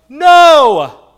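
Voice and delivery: imagined Jesus shouting no at Peter; sharp shout. Pretend. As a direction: bark the no like a stern master.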